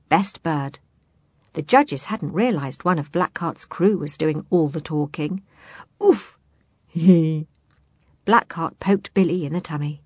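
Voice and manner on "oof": oof. Acted. Blackheart poked Billy.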